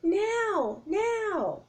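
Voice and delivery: high urgent repeated call; shouting. Acted. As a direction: hammer the word home. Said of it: "shouted Jack".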